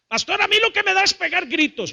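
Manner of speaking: high-pitched confession to the pastor; high mock complaint; imitated congregant. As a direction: voice a worked-up churchgoer, pitch way up.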